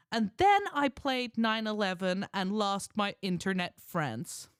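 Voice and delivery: loud, slow, high put-on American voice; American accent. Husband restarting his wife's written comment, emphatic American accent. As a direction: declaim it slowly as an American wife. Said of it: "I'll read her comment in her accent".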